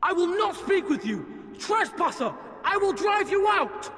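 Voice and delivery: high, forceful outburst at an intruder; angry threat. Acted. Game character threatens a trespasser, angry. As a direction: drive them off with a high, angry cry.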